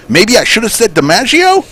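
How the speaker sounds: high talking-dog punchline. Acted. Dog's punchline in joke.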